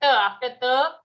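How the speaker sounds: normal talking voice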